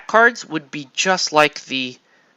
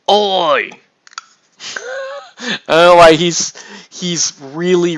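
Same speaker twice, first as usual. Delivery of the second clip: put-on broad Australian accent, higher and louder; 6 semitones higher, 6 dB louder than usual. Hobbyist goofing in an Australian accent while painting. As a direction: slip into a cheeky Australian voice. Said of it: "really Aussie now".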